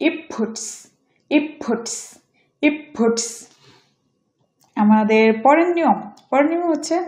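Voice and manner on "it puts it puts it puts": it said "ip" before puts; assimilated T. Shown as a demonstration. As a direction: end it on p, running into puts. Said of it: "এটা উচ্চারণ হবে".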